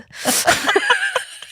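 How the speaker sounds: high burst of laughter. Real, not acted. Laughs at her own retelling.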